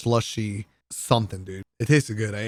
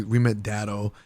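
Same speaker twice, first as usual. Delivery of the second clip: normal talking voice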